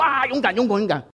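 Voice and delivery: high-pitched burst of bravado; gung-ho crowd. Acted. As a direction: act out everyone's eager first cheer. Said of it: "一开始都说".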